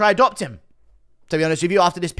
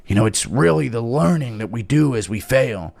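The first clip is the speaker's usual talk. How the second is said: lightly voiced preachy dad, lower; mock a father dispensing life lessons; Chandler impression; 7 semitones lower than usual. Father lecturing his kids, hammed-up earnest dad. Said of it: "Little impression of Chandler and his kids".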